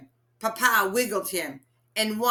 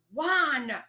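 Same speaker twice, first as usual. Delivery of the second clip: firmly; 6 semitones higher than usual. A narrator's reading. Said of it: "Mama said firmly".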